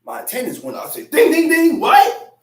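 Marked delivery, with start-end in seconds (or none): 1.1-2.4 loud, high, eager "ding ding ding"; mock-excited call to a fight; fired-up challenge. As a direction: ring the bell, ready to fight.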